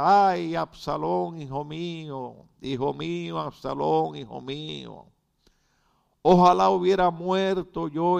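mournful outcry, voiced as a dramatic reading; acted weeping lament. Preacher reading David's cry for his dead son, acted lament. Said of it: "lloraba y decía".

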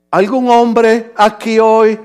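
very slow, measured question. Shown as a demonstration. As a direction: ask it again word by word, unhurried. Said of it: "más despacio".

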